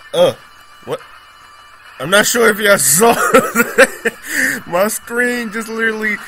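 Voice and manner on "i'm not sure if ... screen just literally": laughing while talking; giggling through the words. Real, not acted. Laughing at screen glitch.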